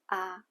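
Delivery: "ah" said short on a low pitch; brief low "ah". Shown as a demonstration. Teacher repeating the third tone demo, short and low. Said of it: "it sounds like a short low tone".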